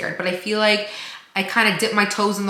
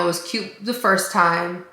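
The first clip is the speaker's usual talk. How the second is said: ordinary talk, nothing marked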